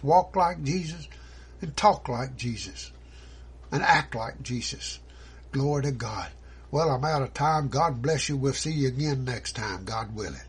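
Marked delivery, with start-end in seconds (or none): none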